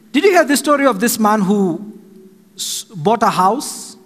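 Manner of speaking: usual speaking voice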